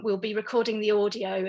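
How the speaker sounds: normal talking voice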